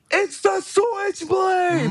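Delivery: high, stretched-out commentator scream; Nigel McGuinness. Retold or imitated. Commentator marking out at the return, screaming. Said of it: "Nigel McGuinness legitimately screaming".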